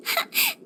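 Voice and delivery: a very high, soft squeal of a giggle. Acted. Healer teases the player about being cute, very high giggle. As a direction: let a tiny giggle slip out.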